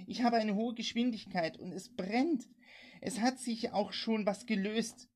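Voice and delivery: high, urgent cries of terror; woman begging for rescue from fire; panicked distress call. A narrator's reading. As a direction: raise the pitch, frantic and desperate. Woman burning, radioing for help.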